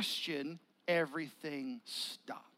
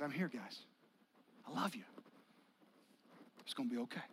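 tender Jesus; 6 semitones lower, 9 dB quieter, faster than usual. Acted. Jesus voiced comforting disciples.

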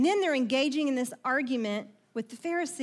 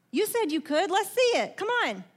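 mocking dare, pitched up and louder; taunting challenge; 7 semitones higher, 3 dB louder than usual. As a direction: goad them like a jeering onlooker. Pharisees taunting the disciples.

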